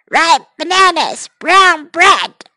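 mock parrot squawk. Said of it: "squawked the parrot".

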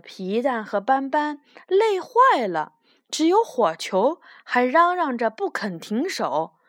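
plain reading voice

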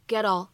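"get all" linked, t becomes a quick flap. Shown as a demonstration. Teacher showing learners "get all" linked with a flap. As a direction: join "get all" as one word, American flapped t. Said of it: "还会形成一个闪音的处理".

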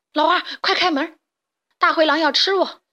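frightened little pig begging at the door; panicked plea. Acted. Pig fleeing the wolf.

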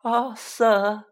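high, tearfully moved elf; high-pitched elf exclamation; gasping house-elf. Acted. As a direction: gasp it out high, overcome with admiration. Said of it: "he gasped".

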